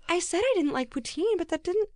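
mocking a friend like a nagging mom, high and whiny; mom imitation. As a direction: nag like an offended mother. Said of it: "You sound like my mom".

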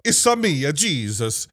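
hammed-up Italian accent, high on the first words; jokey fake Italian accent as Jesus; mock Italian accent, lightly held; bad Italian accent. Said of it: "It's a bad accent. It's silly".

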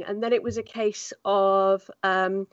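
normal talking voice

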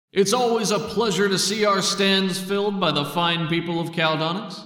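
high, loud hype announcer voice; stadium announcer. Acted. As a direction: welcome the crowd like an arena announcer. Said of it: "the announcer comes on".

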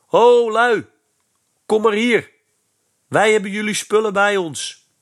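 high, loud hail across the park; calling out from behind. Acted. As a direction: yell to get the boys' attention from a distance. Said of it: "hoorde achter zich roepen".

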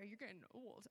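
low, soft teasing warning; mock ominous. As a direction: drop it low and hush it like a spooky joke. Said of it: "I'm joking".

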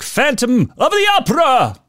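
high, sung-out operatic announcement; dramatic title. Pretend. Host hamming up the old movie title, mock operatic. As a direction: announce it like a grand theatre poster.

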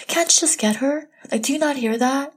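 high, exasperated mock yell at husband; retold snap at husband. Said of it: "I would just yell".

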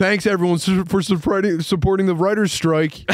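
high-pitched, put-on gratitude; mock upbeat thanks. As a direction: thank supporters with fake cheer. Acted tweet continues after laughter.

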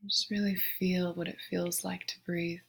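soft, unhurried meditation voice with long pauses; guided meditation. Real, not acted. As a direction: guide them gently into the breath, hushed and spacious. Leads a breathing meditation.

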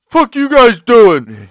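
high, drawn-out loud holler; imitated yelling. Jane confronting guys standing there, imitated yelling. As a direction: bellow it slowly as a hammed-up loudmouth. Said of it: "loud-ass mouth yelling and shit".

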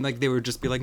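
ordinary delivery

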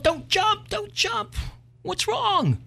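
woman's voice pitched far up, calling out; high woman's yell. Acted. Woman on bridge yells to jumper, high and urgent. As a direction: shout high to stop him jumping. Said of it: "she yelled".

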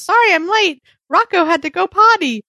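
high-pitched, singsong character quote; Zoe. Retold or imitated. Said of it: "Zoe comes in and says".

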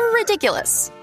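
normal talking voice

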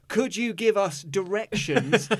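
slow and careful. Retold or imitated. English tourists asking a supposed local, very slow and careful. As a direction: speak slowly and carefully, as if to a foreigner. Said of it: "very slowly and carefully".

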